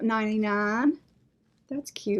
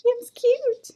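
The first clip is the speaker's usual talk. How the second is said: delighted coo; 10 semitones higher, slower than usual. Real, not acted. Cooing over a cute pumpkin.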